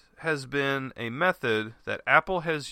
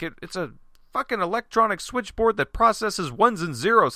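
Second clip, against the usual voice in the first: ordinary talk, nothing marked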